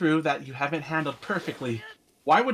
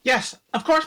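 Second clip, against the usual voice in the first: startled; 8 semitones higher, 6 dB louder than usual. Acted. Startled maid answers knock.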